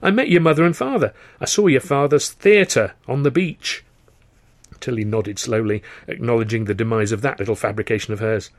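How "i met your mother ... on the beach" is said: high, forceful acted rejoinder; insist, pitch leaping up; emphatic insistence. Countering a friend's disbelief about Southend, emphatic.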